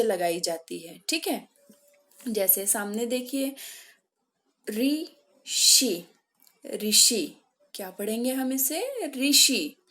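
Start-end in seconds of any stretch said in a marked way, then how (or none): 4.7-7.4 "ऋषि" with the opening ऋ said as "ri"; deutliche Ausspracheübung. Shown as a demonstration. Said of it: "इसका उच्चारण री होता है". 9.1-9.8 "ऋषि" said loudly as "ri-shi"; pronunciation model. Shown as a demonstration. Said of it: "इसका उच्चारण री होता है".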